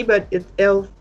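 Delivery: ordinary delivery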